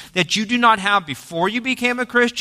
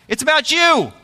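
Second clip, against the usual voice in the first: higher, bright address to a neighbor; telling your neighbor; 7 semitones higher than usual. Shown as a demonstration. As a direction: turn and tell the person beside you. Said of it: "turn to your neighbor right now and tell them".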